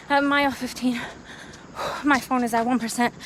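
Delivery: ordinary delivery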